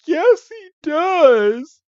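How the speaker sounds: put-on very high, slow little girl voice on the verge of tears; hammed-up upset little girl defending her brother; tearful little girl. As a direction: plead it in a tiny high voice. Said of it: "And she starts to cry".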